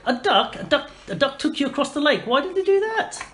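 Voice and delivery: high-pitched astonished questioning; incredulous listener. Acted. Townsfolk hear of the duck ferry, high amazed disbelief.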